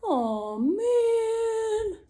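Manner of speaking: drawn-out "man" sliding up high; comic, stretched-out dismay; disappointed bear. Acted. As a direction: groan it out as a let-down bear. Bear left awake while friends snore.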